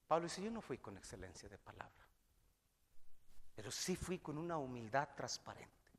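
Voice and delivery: preacher hushing the church for the closing story; soft preaching. Acted. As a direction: draw them in with a hushed voice.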